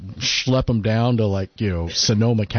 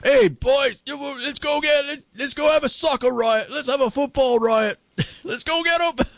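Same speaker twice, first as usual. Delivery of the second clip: high-pitched rowdy Cockney hooligan, hammed up; rally the lads in a squealed mock-Cockney orc voice. Joking that Cockneys sound like orcs rousing a soccer riot, high mock Cockney. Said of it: "Cockney accent sounds like the orcs".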